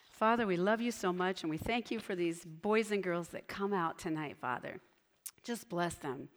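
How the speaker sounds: prayer. Acted. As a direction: pray over the children gently. Leads a closing prayer.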